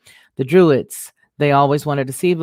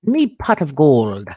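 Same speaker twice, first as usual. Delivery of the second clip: slow, hammed-up stage-Irish leprechaun voice; mock leprechaun Irish; slower than usual. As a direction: growl out "me pot" like a greedy Irish leprechaun. Voicing a leprechaun.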